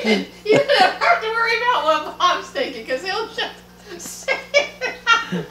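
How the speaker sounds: high-pitched words squeezed out through real laughter; laughing while talking. Real, not acted. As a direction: laugh your way through the line. Host laughs while answering.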